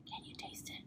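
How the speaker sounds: playful teasing whisper. Pretend. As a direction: lean in and whisper.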